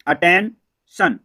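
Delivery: Attention drawn out very slowly, a-ten-shan; slow model word. Shown as a demonstration. Teacher modelling the word for students, very slow. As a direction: stretch attention out slowly for the class. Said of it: "तो इसको पढ़ते हैं attention".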